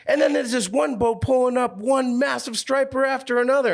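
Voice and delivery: high, worked-up mimic of a guy on the phone; excited fisherman. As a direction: play the buddy losing his mind over the bite. Same fisherman continues his report.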